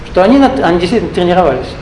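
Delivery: usual speaking voice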